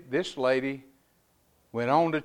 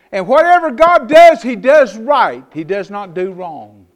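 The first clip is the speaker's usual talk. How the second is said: full-force preaching; 6 semitones higher, 14 dB louder than usual. Acted. Pastor declaring God's rightness to the church, loud. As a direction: drive the truth home.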